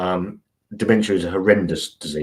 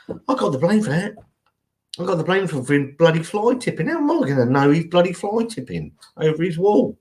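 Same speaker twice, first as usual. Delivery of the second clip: high put-on voice whining about the blame; mock grumbler; 8 semitones higher than usual. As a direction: whine like the falsely blamed neighbour. Mock-voicing a grumbling neighbour's complaint.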